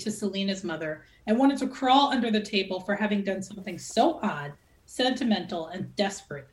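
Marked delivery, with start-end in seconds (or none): none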